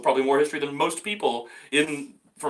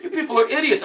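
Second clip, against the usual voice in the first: scornful retort; 11 semitones higher, 4 dB louder than usual. Retold or imitated. His retort to New Yorkers.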